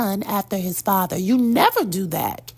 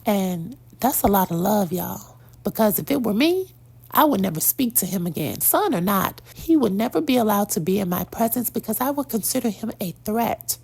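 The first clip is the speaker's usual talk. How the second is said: normal talking voice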